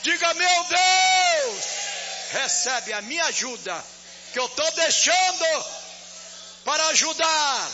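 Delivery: high, shouted offering prayer; full-force preaching. Real, not acted. Pastor shouts the offering prayer over the church, full-force preaching. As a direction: preach it at the top of your voice.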